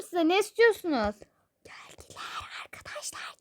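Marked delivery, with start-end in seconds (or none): 1.6-3.4 secret whisper to the viewers; conspiratorial whisper; whisper to camera. Real, not acted. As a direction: let the audience in on it quietly. Mother whispers to viewers, plotting.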